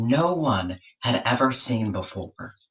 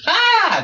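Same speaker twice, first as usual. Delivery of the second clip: very high piercing shriek; creature scream; 7 dB louder than usual. Acted. Platypus startled by George's touch, piercing high scream. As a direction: shriek in sudden fright, way up high. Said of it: "And the creature screamed".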